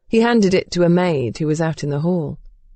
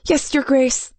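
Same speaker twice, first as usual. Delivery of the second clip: high, meek reply; chastened; 8 semitones higher than usual. A narrator's reading. Maid told to burn the sheets, chastened. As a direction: accept the rebuke and obey. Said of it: "sounding chastened".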